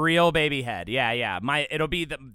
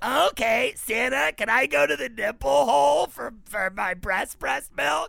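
put-on high-pitched pleading baby voice; put-on high little-kid voice; baby head talk; 10 semitones higher than usual. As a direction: ask Santa like a cartoon baby. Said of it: "would you have baby head talk".

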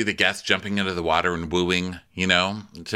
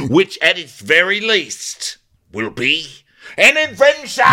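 slow, louder, climbing to a high shouted "adventure"; hammed-up doctor captain; 6 semitones higher, 6 dB louder than usual. Host parodying the captain as a doctor. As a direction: build to a manic cry. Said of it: "parody captain diagnosis".

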